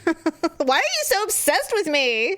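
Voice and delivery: high-pitched playful protest; mock exasperation. Sister talks back to a stalking mantis, mock exasperated. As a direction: whine it at a pest, half joking.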